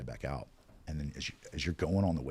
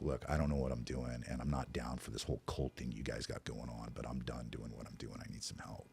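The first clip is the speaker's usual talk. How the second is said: quiet surrender; 4 semitones lower, 8 dB quieter than usual. Retold or imitated. Giving in, asking sponsor for help.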